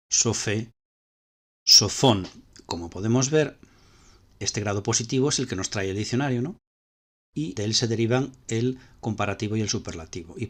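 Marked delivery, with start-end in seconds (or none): none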